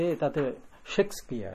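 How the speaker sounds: ordinary talk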